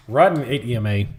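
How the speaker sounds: ordinary talk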